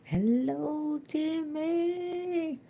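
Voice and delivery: high, sustained singsong hello; drawn-out calling greeting. Real, not acted. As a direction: call out a long, high-pitched hello.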